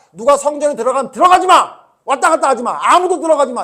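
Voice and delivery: loud, high shouted prohibition; Jesus's angry command. Retold or imitated. Jesus barring everyone from the temple, angry shouted command. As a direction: forbid the whole crowd at full voice. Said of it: "이랬던 거예요".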